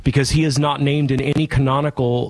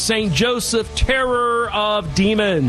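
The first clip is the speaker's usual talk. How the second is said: raised, solemn blessing voice, pitched higher; priestly blessing; 6 semitones higher than usual. Real, not acted. Priest blessing radio listeners at show's end, solemn intoning. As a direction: intone it like a benediction over the listeners.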